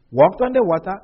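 usual speaking voice

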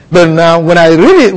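usual speaking voice

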